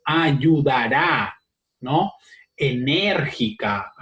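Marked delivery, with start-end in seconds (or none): 0.0-1.3 "ayudará" drawn out very slowly, every syllable shaped wide; exaggerated articulation. Shown as a demonstration. Teacher to students, stretching "ayudará" very slowly with wide mouth. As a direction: mouth each syllable of "ayudará" big and slow. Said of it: "Cuando digo".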